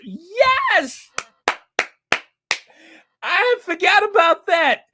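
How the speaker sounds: high, loud excited outburst; thrilled shout. Real, not acted. Host thrilled by a viewer's reminder, shouting high.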